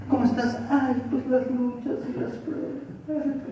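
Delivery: high, soft, slow put-on whine; defeated whine. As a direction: sigh it out as a mock sufferer. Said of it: "lo mismo, pero con otra actitud".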